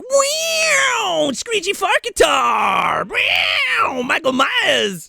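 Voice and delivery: high, shouted, over-the-top fake excitement; mock hype shout. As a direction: yell it like a cheesy trailer hype-man. Mocking the theatrical cut's hype.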